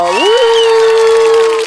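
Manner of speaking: long, high, loud "woo"; piercing drawn-out cheer; celebratory whoop. Real, not acted. Cheering the new chef in family.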